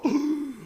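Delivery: sharp, high gasp of astonishment; shocked gasp. Acted. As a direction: suck in breath as a startled onlooker. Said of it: "다 깜짝 놀라". (깜짝 놀라 숨 들이켜는 소리)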